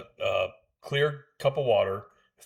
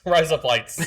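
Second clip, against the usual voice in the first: louder, higher mock Australian; playful faked Australian accent; 5 semitones higher, 5 dB louder, slower than usual. Shown as a demonstration. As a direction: try out a joke Australian accent. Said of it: "That's how you speak Australian".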